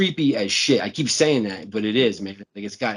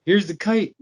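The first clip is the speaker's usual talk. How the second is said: playful dad call; 10 semitones higher, 5 dB louder than usual. Retold or imitated. Voicing the dad playing.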